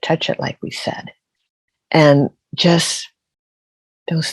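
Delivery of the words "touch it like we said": whispered, insistent scolding; whispered insistence. Retold or imitated. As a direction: hiss it like the ancestors insisting. Ancestors scolding, voiced.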